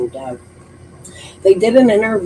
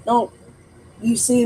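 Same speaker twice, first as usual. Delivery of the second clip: ordinary delivery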